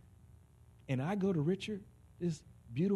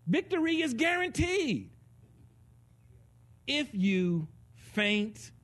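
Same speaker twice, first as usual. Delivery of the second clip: God urging; 10 semitones higher, slower than usual. Retold or imitated. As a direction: push it out high and strong, stretching the last words. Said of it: "But God says".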